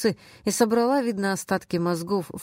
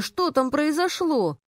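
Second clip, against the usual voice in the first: high, drawn-out mock-tearful question; tearful cry; 9 semitones higher, slower than usual. A narrator's reading. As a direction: wail it mildly, pitched well up and slowed. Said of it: "вскричала она со слезами в голосе". (жалобный вскрик, слегка со слезами)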